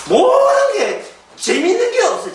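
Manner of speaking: 모든 게 재미있는 게 없어요 whined high; moaning retiree. Retold or imitated. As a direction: whine like a bored old man. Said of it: "은퇴하시고 난 뒤에".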